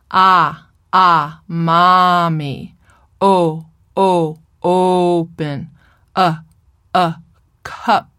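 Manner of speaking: each vowel held and repeated, then the word spoken through it; vowel set first. Shown as a demonstration. A therapist demonstrating vowel-first stimulation. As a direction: sustain oo, e, ah, o, a and slide into moo, mommy, open, cup. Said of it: "set the vowel and speak the consonant through it".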